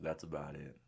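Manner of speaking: ordinary delivery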